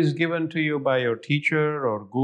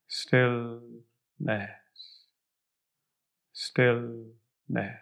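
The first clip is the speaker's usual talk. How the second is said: guided meditation; 3 semitones lower, 3 dB quieter than usual. Acted. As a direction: fade softer toward silence. Guided visualization meditation continues.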